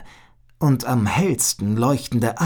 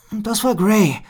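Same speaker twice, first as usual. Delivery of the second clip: ordinary delivery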